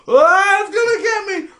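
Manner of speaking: high mock-panicked cry; imitated fright. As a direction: cry out as if the water is coming for you. Acting out fear of the current.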